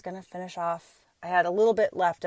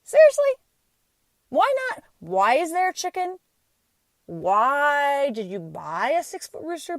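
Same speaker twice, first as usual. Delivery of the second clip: wife shows what her husband should have asked, then exasperated; exasperated disbelief; 6 semitones higher than usual. Pretend. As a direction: act out outraged astonishment. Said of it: "what she wanted him to say".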